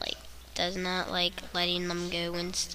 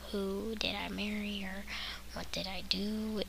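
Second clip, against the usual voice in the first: whispered, stunned; 10 dB quieter than usual. Acted. Newlywed asking themselves in a stunned whisper. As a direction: breathe it out in disbelief.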